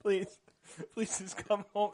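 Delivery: half-laughing imitation of a crying kid; mock tearful plea. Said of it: "he's crying too".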